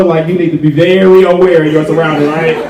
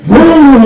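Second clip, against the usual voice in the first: higher, louder vroom; mock engine roar; 10 semitones higher, 6 dB louder than usual. Comedian imitating a car speeding past, mock vroom. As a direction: rev it like a passing car.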